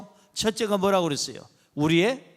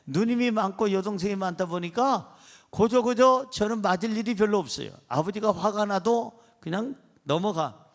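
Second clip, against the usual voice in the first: ordinary delivery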